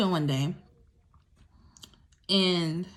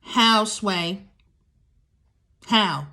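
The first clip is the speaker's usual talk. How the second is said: exasperated; 5 dB louder, much slower than usual. Real, not acted. Exasperated rhetorical question.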